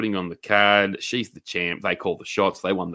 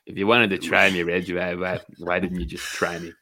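Stu Hart impression; 4 semitones lower than usual. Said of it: "Sounded like fucking Stu Hart".

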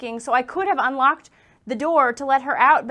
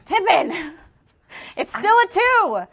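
frustrated shout; 6 semitones higher, 7 dB louder than usual. Real, not acted. Bad reroll, frustrated shout.

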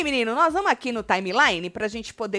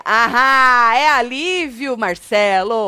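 loud, drawn-out crow of being right; triumphant retort; 8 dB louder, much slower than usual. Real, not acted. Host proves her point to Marcelo, loudly triumphant. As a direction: crow it out with stretched vowels.

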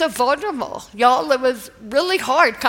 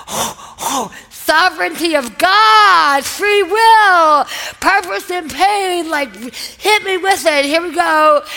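gasp, then loud, higher, drawn-out cries; mock eager bracing; 5 semitones higher, 6 dB louder, slower than usual. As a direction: call out the big topics like a hyped-up contestant. Acting out bracing for big questions.

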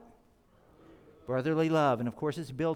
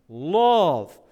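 one word barked much louder; barked command; 2 semitones higher, 11 dB louder than usual. Shown as a demonstration. Pastor shows how John did not say it, barked loud. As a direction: snap it out like an order. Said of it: "not barking a command".

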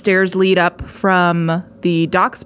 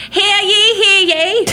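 high, loud town crier call; 12 semitones higher, 4 dB louder, slower than usual. Acted. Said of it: "stereotypical, uh, town crier".

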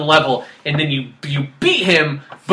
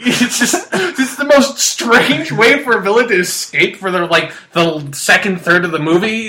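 ordinary talk

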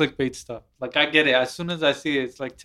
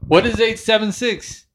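higher, louder angry outburst; angry scammer; 7 semitones higher, 4 dB louder than usual. Retold or imitated. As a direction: snap back at the caller in a rage. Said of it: "And he's like".

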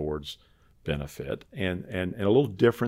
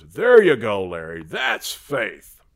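big approving voice; 3 semitones higher, 10 dB louder, slower than usual. Retold or imitated. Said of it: "his response was".

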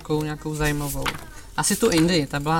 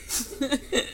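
quiet high giggle; stifle a laugh at the joke; soft laugh; 9 semitones higher, 6 dB quieter than usual. Real, not acted. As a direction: narrator giggling at the chef's reply. Laughs at own story.

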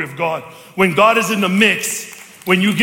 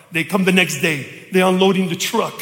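usual speaking voice

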